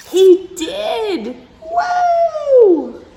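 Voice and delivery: high, loud triumphant shout; excited cheer. Acted. Reading to kids, cheering Thor's big moment. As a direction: cheer like he finally lifted it.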